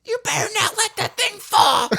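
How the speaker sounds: put-on high old-lady warning; high mock old-lady nag; mimicking Arlene. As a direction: call out the window like a nagging old woman. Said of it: "she pops out of her window".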